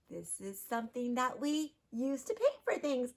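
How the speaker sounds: ordinary talk, nothing marked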